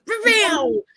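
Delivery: high-pitched zoomies cat cry; imitated cat yowl. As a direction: yowl like a cat about to bolt. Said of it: "She goes".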